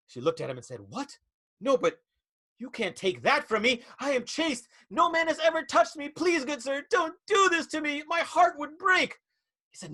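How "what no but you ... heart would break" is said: clutch your pearls and beg; swooning heroine. Acted. Heroine protests her chastity.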